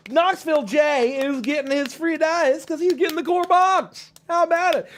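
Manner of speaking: high-pitched game-show hype; excited announcement. Real, not acted. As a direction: announce a prize winner to the crowd. Announcing a prize to audience.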